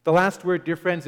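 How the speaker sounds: ordinary talk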